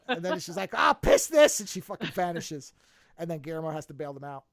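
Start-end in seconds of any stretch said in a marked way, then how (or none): 0.8-1.6 high, loud exasperated snap; angry outburst. Retold or imitated. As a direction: blurt it out fed up. Witch storms off in anger.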